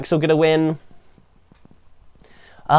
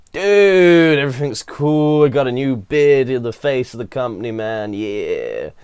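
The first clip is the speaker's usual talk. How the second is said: Rob Van Dam promo. Retold or imitated. Wrestling reviewer mimicking a backstage promo, laid-back impression. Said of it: "a very Rob Van Damme promo of like".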